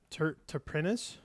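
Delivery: terpenes? said slowly, unsure, rising; terpenes? stumbled over, rising at the end; unsure pronunciation. Shown as a demonstration. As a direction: sound out terpenes? haltingly, like a question. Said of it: "I still don't know how to say that word".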